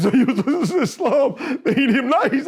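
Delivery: real laughter through the words; high, loud, amused delivery breaking into laughs; laughing. Real, not acted. Laughing at his own story.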